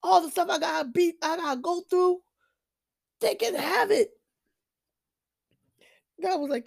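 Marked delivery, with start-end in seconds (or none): 0.0-4.1 in my feelings. Retold or imitated. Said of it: "I was in my feelings".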